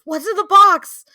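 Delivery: "What's in the box?" yelled high and loud; Seven movie yell. Retold or imitated. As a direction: scream it like the film's climax. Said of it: "Seven of".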